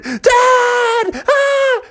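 very high frantic yell; panicked Froggy. Acted. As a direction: scream for Dad to switch it off. Froggy dangling high in the air.

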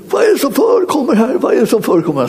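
high-pitched imitation of an outraged yell; mock shout. Priest bursting in on the forbidden prayer meeting, mock shouting. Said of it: "skrek han då så här".

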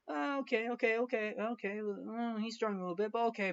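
high, soft parental fretting; uneasy parents. Retold or imitated. Victor's parents watching him struggle, high and hushed. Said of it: "Victor's parents are like".

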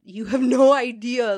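loud burst of frustration; exasperated outburst. Retold or imitated. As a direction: blurt it out, fed up. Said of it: "And I said".

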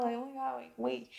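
hushed, startled oh my God; soft flustered gasp of surprise. Retold or imitated. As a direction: caught in a towel, dropping to a near hush. Caught off guard in towel.

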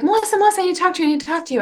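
high, rushed, breathless calling of a name; lightly voiced eager girl pleading to talk; urgent eager plea. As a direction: imitate an excited fan running up to you. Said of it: "she's like".

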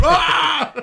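high, loud cartoon scream; mock scream. Character thrown across the room, loud high scream. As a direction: let out a flying yelp.

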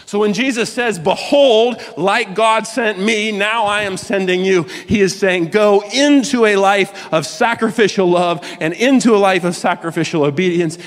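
high-pitched, driving sermon delivery; impassioned preaching. Real, not acted. Preaches at full force.

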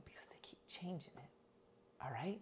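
whispered scolding in the pew; hiss-whisper an argument so nobody hears; hushed church argument. Retold or imitated.

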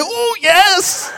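high, loud rapturous ooh yes; sway into it like a holy embrace; ecstatic yes. Retold or imitated. Hospital woman hugging the pastor and pulling him down, rapturous yes.

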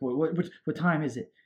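high, stammering mock panic; mock freak-out. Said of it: "Mark is freaking out".

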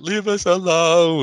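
high, slow squeaky plea; high quote voice. Retold or imitated. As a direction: squeak it out slowly. Imitating movie line for cat.

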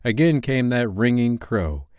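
ordinary delivery, nothing marked

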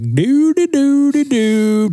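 high, far-off singsong humming; mock oblivious dawdler. Mocking slow people in the aisle with a high humming. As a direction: hum a high dopey tune like a slow walker.

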